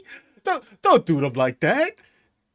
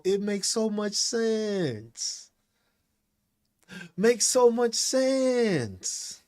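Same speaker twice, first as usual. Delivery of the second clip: sarcastic; 3 semitones higher, 6 dB quieter, much slower than usual. Acted. Mock agreement about subsidies.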